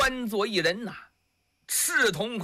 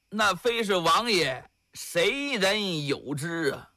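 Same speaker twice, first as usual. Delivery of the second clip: ordinary delivery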